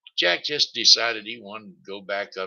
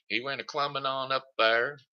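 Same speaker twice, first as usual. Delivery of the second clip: ordinary delivery, nothing marked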